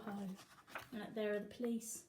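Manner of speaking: ordinary delivery, nothing marked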